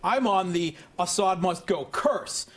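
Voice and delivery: ordinary talk, nothing marked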